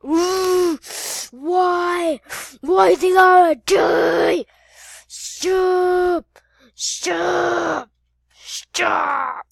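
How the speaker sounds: loud, high, anguished cries; hammed-up agony; pained wailing. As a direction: wail and beg them to stop. Victim wails while being hurt.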